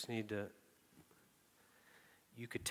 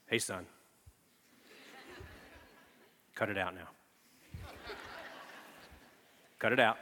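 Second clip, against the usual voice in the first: father voice. Retold or imitated. Dad trying a firm father voice on baby. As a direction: put on the stern dad voice. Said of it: "He needs to hear father voice".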